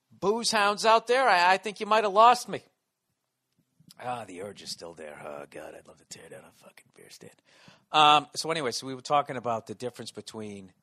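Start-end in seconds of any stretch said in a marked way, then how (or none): 0.1-2.6 higher, louder mock proclamation; playful calling out. Host warning drinking listeners he's converted, loud teasing. 4.0-7.3 low, soft murmur trailing away; longing confession. Real, not acted. Host admitting he still craves beer, low murmur.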